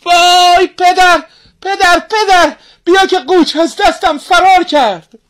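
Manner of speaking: put-on girl yelling the alarm, loud and high; crying out. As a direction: call out to the father in mock panic. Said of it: "فریاد زد".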